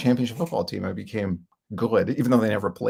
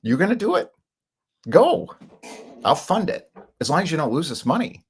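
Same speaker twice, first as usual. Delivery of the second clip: much higher and louder, peaking on "Go"; imitating carefree dad; 8 semitones higher, 3 dB louder than usual. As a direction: play the dad waving him on, loud and high. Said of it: "He's like".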